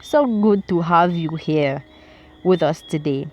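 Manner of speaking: put-on light Italian accent, warm host's welcome; lightly Italian-accented host, beaming. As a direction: greet them as an Italian restaurateur. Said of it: "In his Italian accent".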